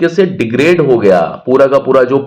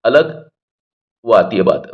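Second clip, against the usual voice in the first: ordinary talk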